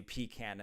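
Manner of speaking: "pecan" as PEE-can; Southern pecan. Shown as a demonstration. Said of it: "in the South".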